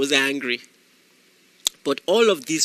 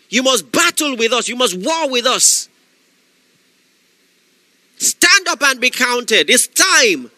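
higher, louder shouted preaching; fiery preaching; 5 semitones higher, 3 dB louder than usual. Acted. Preacher exhorting listeners not to make excuses, shouting. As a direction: preach it to the crowd, full force.

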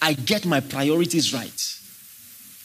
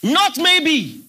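NOT maybe, louder and higher, stress on Not; preaching emphasis; 9 semitones higher, 5 dB louder than usual. Acted. Preacher insisting to congregation: louder, Not stressed. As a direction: hammer the correction home to the church. Preacher repeats with more force.

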